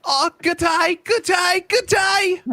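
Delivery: high squeaky Aussie greeting; shrill mock Aussie good day; Australian accent. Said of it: "She was like".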